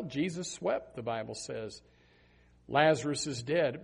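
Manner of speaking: ordinary talk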